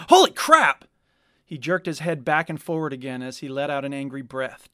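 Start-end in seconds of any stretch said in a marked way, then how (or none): 0.1-0.9 sudden high, loud yelp; startled outburst. A narrator's reading. Dactyl startled by Chirwa right beside him, yelping. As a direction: jump out of your skin.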